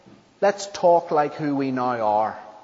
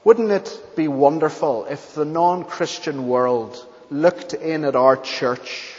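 ordinary talk, nothing marked

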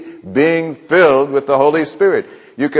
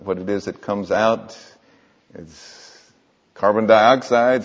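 normal talking voice

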